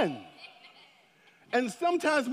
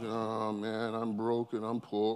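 much lower, softer, sorry-for-himself moan; mopey prince voice; 12 semitones lower, 8 dB quieter, slower than usual. Retold or imitated. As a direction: moan like a prince down on his luck. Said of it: "I bet he doesn't walk around like".

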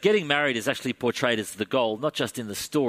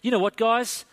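high, loud, breezy reassurance; cheerful apostle voice, pitched up; Paul voice; 11 semitones higher, 4 dB louder than usual. Acted. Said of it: "the Apostle Paul says to the Corinthian Christians".

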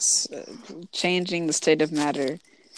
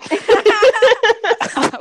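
loud drawn-out; 10 dB louder than usual. Acted. Repeats the loud noise, drawn out.

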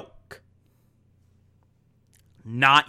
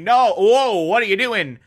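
higher, louder mock alarm; alarmed cry; 5 semitones higher, 4 dB louder than usual. Philip and Elizabeth facing Stan's gun, higher and louder. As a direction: blurt it out startled. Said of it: "Philip and Elizabeth saying".

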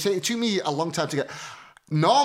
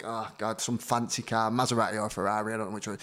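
hushed, much softer aside to himself; muttered to himself; 6 semitones lower, 5 dB quieter than usual. Retold or imitated. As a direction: keep it under your breath, much softer. Muttered thought about the car.

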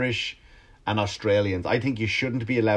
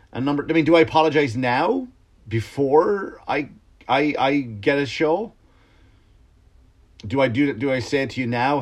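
usual speaking voice

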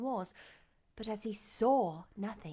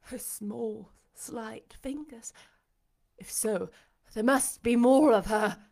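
dawning recognition; 8 dB louder than usual. A narrator's reading. Blind master feeling Jane's fingers, wonder building to urgency.

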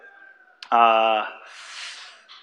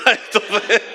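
laughing, trailing into next words; riendo; 6 semitones higher than usual. Real, not acted. Risa tras su pregunta.

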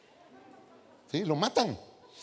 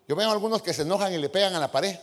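ordinary delivery

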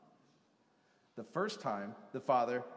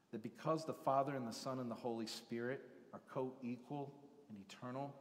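ordinary delivery

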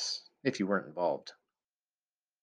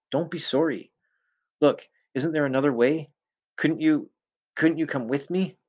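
desperate pleading; 7 semitones higher, 3 dB louder than usual. Acted. Begging Mary to come with him instead, high desperate plea. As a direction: pitch climbing high as he begs, a bit louder.

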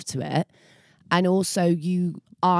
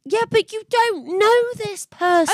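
much higher, heated; exasperated; 4 dB louder than usual. Real, not acted. Friend rebutting Freya's defence, high exasperated. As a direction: push back hard.

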